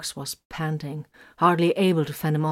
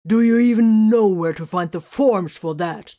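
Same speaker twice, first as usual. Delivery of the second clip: loud, higher furious taunt; furious demand; 4 semitones higher, 9 dB louder than usual. A narrator's reading. Titus mocks Grimfang, loud and furious. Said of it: "Titus demanded furiously".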